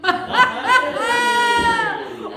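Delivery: loud hearty laugh; big laugh. Real, not acted. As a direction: burst out laughing. Laughing at punchline.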